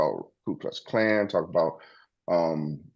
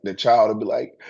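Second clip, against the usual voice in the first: normal talking voice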